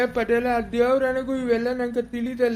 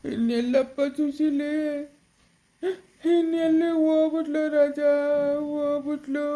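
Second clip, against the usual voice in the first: sobbing grief. Acted. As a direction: break the news through sobs. Tells relative that Susheela died.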